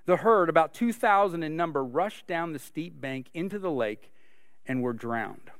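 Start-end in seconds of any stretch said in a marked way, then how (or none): none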